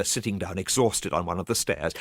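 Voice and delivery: ordinary delivery, nothing marked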